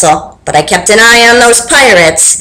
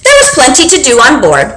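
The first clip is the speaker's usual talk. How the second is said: usual speaking voice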